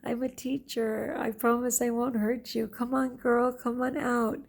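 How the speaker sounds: high, drawn-out call to someone hiding; imitated calling out. Teacher calling a hidden girl out of the desert, imitated yelling. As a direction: call out coaxingly, pitched well up. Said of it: "I'm yelling".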